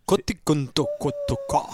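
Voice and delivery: very high squawking duck noises. Pretend. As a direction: honk it out like a cartoon duck. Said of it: "fais le canard".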